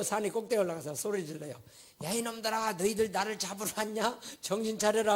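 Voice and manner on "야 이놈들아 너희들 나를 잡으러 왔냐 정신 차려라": ordinary talk, nothing marked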